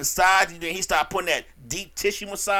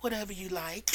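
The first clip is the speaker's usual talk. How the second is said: much softer, melting surrender; mock dreamy client; 14 dB quieter than usual. Client surrendering to the masseur, soft hammed-up sigh. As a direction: give in sweetly.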